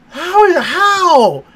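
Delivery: high, loud, slow incredulous outcry; stunned disbelief. Real, not acted. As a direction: drag out the disbelief. Disbelief she drowned.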